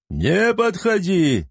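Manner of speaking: high, slow, panicked shout; hysterical yell. A narrator's reading. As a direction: scream it in panic to keep them back. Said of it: "истерично орал один из них". (истеричный крик)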